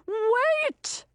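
sudden high shout of "Wait!". Acted. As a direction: cry out to stop the fight. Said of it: "shouted the sea monster".

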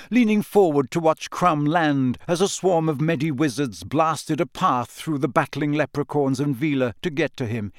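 usual speaking voice

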